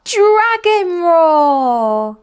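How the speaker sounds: long, slow, very loud high roar; shouted roar. Acted. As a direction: roar it out like a little dragon. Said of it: "and shout".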